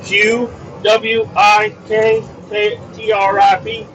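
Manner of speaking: slow, high-pitched letter-by-letter spelling. Shown as a demonstration. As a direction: space out each letter for the listener. Spells out the store name.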